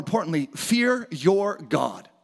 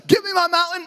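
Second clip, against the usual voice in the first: Caleb's shout; 9 semitones higher than usual. Retold or imitated. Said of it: "Caleb said".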